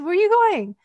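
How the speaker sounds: mock Hawaiian pidgin, eager question. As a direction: imitate a curious local Hawaiian guy. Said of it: "in his pidgin English".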